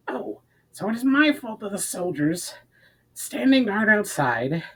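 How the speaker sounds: high, strained snarl of a short, breathless companion; lightly voiced little thief, indignant and winded. A narrator's reading. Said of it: "snarled the shortest".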